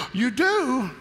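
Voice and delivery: high, delighted disbelief; can't believe the good news; mock surprise. Salesman answering the customer, high and amazed.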